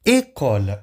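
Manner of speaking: "École" with a closed first e; French acute e. Shown as a demonstration. Teacher presenting école to students, French acute accent. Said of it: "nas palavras école".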